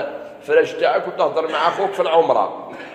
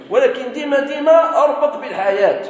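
much higher, urging always link it to life; emphatic exhortation; 10 semitones higher than usual. Real, not acted. Preacher urging listeners to tie Islam to daily life, emphatic. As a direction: press the point home with rising zeal. Preacher stressing his point.

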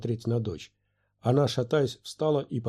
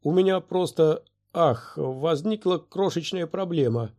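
higher-pitched, halting excuse; babbling hesitantly; 6 semitones higher, 4 dB louder than usual. A narrator's reading. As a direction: stumble mildly over an awkward admission. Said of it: "пролепетал Диди".

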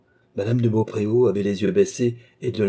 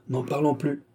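higher-pitched, cool dismissal; coldly; 7 semitones higher than usual. A narrator's reading. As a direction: close the matter coldly. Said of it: "dit froidement monsieur de Beaupréau".

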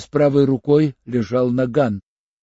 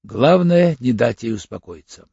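plain reading voice

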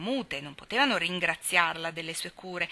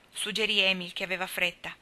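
plain reading voice

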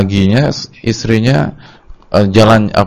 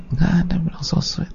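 whispered aside; 5 semitones higher, 10 dB quieter than usual. Real, not acted. Aside dismissing the romance.

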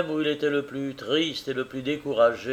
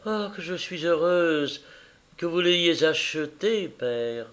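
ordinary delivery, nothing marked

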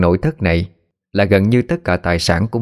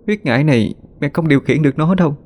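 weak pleading; 6 semitones higher than usual. Acted. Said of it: "cô khó nhọc cất tiếng nói".

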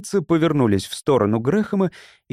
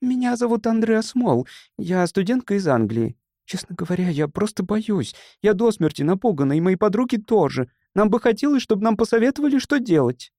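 put-on high-pitched anxious young woman; nervous, timid girl; 8 semitones higher than usual. A narrator's reading. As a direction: speak up shyly in a high, frightened voice. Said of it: "Она сильно волновалась и говорила так тихо".

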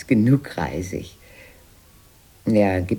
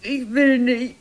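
whiny refusal; 13 semitones higher than usual. Acted. Refusing again.

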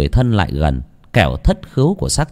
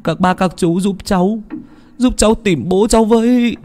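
high, tearful pleading, slowing at the end; a son pleading to find his drowned father; 11 semitones higher than usual. A narrator's reading. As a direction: beg the villagers desperately for help. Son begs villagers to find father.

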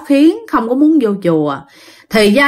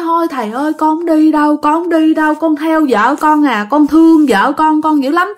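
high, plaintive retold wail; imitating a crying spirit's refusal; tearful pleading; 6 semitones higher than usual. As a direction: beg to stay, voice pitched up. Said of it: "khóc nói".

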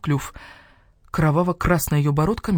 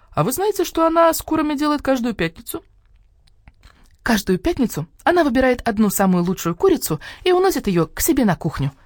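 high eager hen voice; the hen rushing to report; 9 semitones higher, 4 dB louder than usual. Acted. (курица с готовностью, высоко)

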